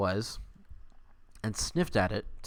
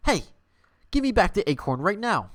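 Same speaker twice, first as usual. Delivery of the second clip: higher, louder angry shout; angry demand; 8 semitones higher, 6 dB louder than usual. Acted. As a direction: yell at the machine to hand it back. Squirrel demands stolen acorn back.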